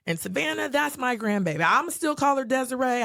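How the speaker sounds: high, proud and insistent; proud declaration. Retold or imitated. Voicing Starla's declaration.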